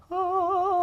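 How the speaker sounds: high, breathless awed "Oh"; starstruck gasp. Retold or imitated. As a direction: gasp as if seeing an angel. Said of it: "It was like".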